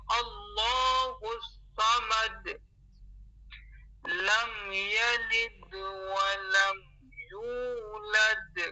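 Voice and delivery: قل هو اللہ احد very slow, each letter distinct; clear recitation. Shown as a demonstration. Teacher modelling the verse, very slow and distinct. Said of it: "بلکہ کیا؟".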